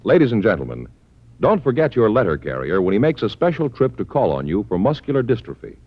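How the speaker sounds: ordinary delivery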